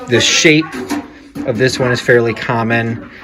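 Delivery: ordinary talk, nothing marked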